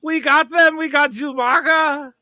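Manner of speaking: a hint of a German accent, proud; lightly put-on German accent, gloating; hammed-up German villain accent; mock German accent. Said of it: "demanding my German accent".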